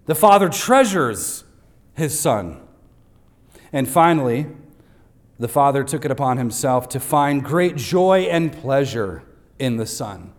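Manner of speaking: ordinary delivery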